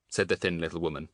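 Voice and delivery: ordinary delivery, nothing marked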